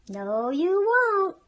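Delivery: loud, slow, emphatic no; firm refusal. Acted. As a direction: put the lazy animals firmly in their place. Hen refuses the lazy animals.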